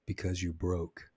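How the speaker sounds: soft, slow murmur in his ear; whispered. Retold or imitated. Wife whispering the reason into Kramer's ear, soft and slow. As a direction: lean close and breathe it out slowly. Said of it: "whispered in his ear".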